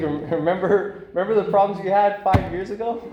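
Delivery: playfully nudging him to look back; teasing reminder. Retold or imitated. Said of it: "It's like".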